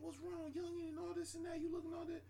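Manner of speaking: high, mumbly old southern man, lightly imitated; southern counter man. Bus-stop cook asking a tired traveler what's wrong, high mock voice. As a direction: mimic the man muttering sweetly at you. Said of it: "the man behind the counter".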